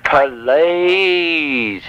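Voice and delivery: long, stretched "Please"; drawn-out plea. Pretend. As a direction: plead with one long, drawn-out word. Begs her to be quieter.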